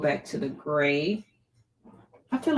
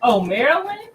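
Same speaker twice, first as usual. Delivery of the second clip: excited protest; 11 semitones higher, 6 dB louder than usual. Real, not acted. Reacting to chat, exclaiming.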